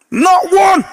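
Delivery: very high, shouted punchline; emphatic exclamation. Real, not acted. Storyteller revealing no volunteers came forward, high shout. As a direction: cry it out in high-pitched disbelief.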